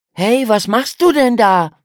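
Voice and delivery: lauter, höherer Zuruf über das Watt; rufend. A narrator's reading. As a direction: ruf deiner Schwester laut zu. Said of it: "rief Lukas seiner Schwester zu". (Junge ruft der Schwester laut zu, höher)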